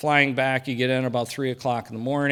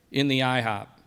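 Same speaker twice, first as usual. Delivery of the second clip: ordinary delivery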